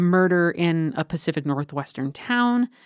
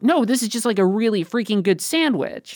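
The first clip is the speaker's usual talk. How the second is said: higher, louder burst of delight as York; mock delighted surprise; 6 semitones higher, 4 dB louder than usual. York tasting the sandwich, mock delighted. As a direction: gush about the sandwich. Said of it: "he's like".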